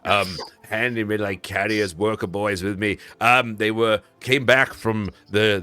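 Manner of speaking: chatty, eager merchant explaining; merchant npc. Acted.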